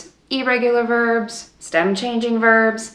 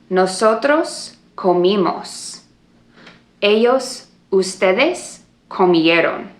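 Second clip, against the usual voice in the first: slow drill for repeating; 2 semitones higher, slower than usual. Shown as a demonstration. Teacher leading a repeat-after-me drill, slow and clear. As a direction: spell out each form for the class to echo. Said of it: "repeat and gesture after me".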